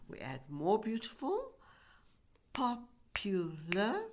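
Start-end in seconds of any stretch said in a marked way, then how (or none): 2.5-4.0 Popular drawn out very slowly, each syllable separate; slow syllable demo. Shown as a demonstration. Teacher counts syllables for the class, slowly. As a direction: spell out Popular slowly by its beats. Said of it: "three syllables".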